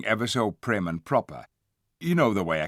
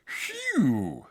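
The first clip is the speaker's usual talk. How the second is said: gasped; 6 semitones higher than usual. A narrator's reading. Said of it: "gasped Eustace".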